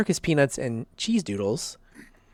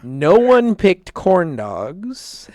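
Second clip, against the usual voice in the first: louder, very slow, each word stretched; drawn-out mock outrage; 5 semitones higher, 7 dB louder, much slower than usual. Host bemoans that nobody picked corn dogs, loud and very slow. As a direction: lean hard on no one and stretch it out.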